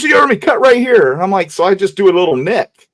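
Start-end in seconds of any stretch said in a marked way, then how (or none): 0.0-1.1 much higher and louder insistent yell; retold yelled order. Grandfather insists the boy cut the hog, impatient yell. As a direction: repeat it like he won't ask twice.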